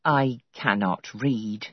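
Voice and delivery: slow, low, understated sadness; a touch of sorrow, said slowly; sad. A narrator's reading. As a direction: housekeeper admitting she cannot read, mildly sad. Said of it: "she shook her head sadly".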